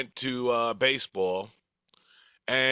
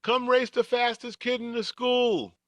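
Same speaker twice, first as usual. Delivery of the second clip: put-on kids calling out; 13 semitones higher than usual. Kids challenging him to race.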